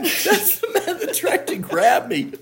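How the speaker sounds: put-on very high, loud child squeal; mock little girl. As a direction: imitate a child tattling in alarm to mother. Voices the frightened little girl again.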